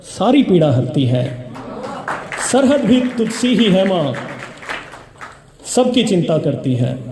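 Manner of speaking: measured, rhythmic reciting of verse; stage poetry reading; poem recitation. Acted. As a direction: declaim each line to the audience, letting it fall.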